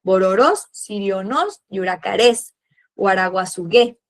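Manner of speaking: Bororós, sirionos, yuracarés, guaraguazugué, each with a lifted final vowel; teacher listing names with final-vowel stress; stress on last vowel. Shown as a demonstration. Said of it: "En la, en la última vocal".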